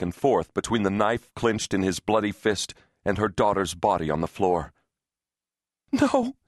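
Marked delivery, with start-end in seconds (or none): 5.9-6.4 very high cry of horror; high-pitched, anguished outburst; horrified cry. A narrator's reading. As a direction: cry out in shock and denial. Mother sees attacker over bleeding daughter.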